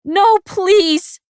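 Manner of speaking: very high loud begging; breaking desperate cry; anguished plea. A narrator's reading. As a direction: plead in horror. Blade sliding into him.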